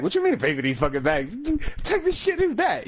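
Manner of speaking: incredulous protest, leaping high at the end; mock-outraged, voice climbing sharply; indignant retort. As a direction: act out outraged disbelief at a charge. Retells arguing over paying for bags.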